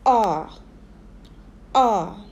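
R said as a long open "ah"; isolated teacher's "ah" for R; modelling the vowel. Shown as a demonstration. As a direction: sound out R alone, falling "ah". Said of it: "help you pronounce the vowel sound".

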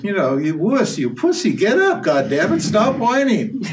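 high mock scolding, breaking into a laugh; Krishna's hammed-up scolding of Arjuna. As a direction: tell the coward to get up. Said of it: "and Krishna's saying".

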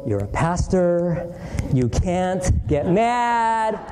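drawn-out stern reminder to oneself; slow, deliberate inner scolding; slow self-admonishment. Retold or imitated. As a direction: stretch the words out, holding back temper.

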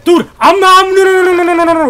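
loud, high string of no's; panicked shout. Real, not acted. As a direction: yell in disbelief. Panicked protest mid-fight.

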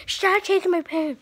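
loud, pleading yell; protesting shout. Real, not acted. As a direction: shout at her to stop. Protesting toy taken.

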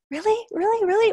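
high-pitched, questioning disbelief; retold doubtful reaction, each word rising sharply; confused, incredulous. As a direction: ask it high, like you can't quite believe it. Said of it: "was first confused because".

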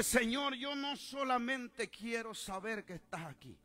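high, loud appeal to the Lord; pleading cry. Retold or imitated. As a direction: cry out in prayer. Retold cry in prayer.

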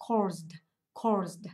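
"caused" ending in a voiced zd. Shown as a demonstration. Teacher showing the class the past form, "caused" ending in zd. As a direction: say "caused" with z running into d. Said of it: "thêm E D vào các bạn sẽ đọc cho cô là".

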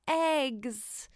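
plain reading voice

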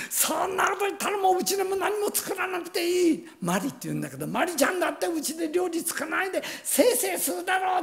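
high, fast angry rant; heated father. Retold or imitated. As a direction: lash out emotionally. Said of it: "感情的になってね".

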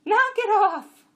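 high, loud, drawn-out order; commanding. A narrator's reading. Jenny breaking up the jacks' fight, commanding shrilly. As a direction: command them at the top of the voice. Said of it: "Chamra commanded".

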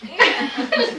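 high, bright laugh breaking out; burst of laughter. Real, not acted. Reader laughs at own story.

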